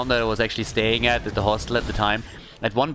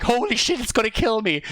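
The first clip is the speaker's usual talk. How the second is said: higher, panicked retold gasp; mock panic; 9 semitones higher than usual. Alone in the dark bathroom with the spider, mock panic. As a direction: blurt it like you're terrified. Panic after the light goes out.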